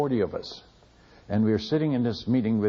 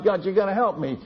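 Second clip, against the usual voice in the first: crying out to God; 6 semitones higher than usual. Retold or imitated. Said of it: "And I'm crying out to God".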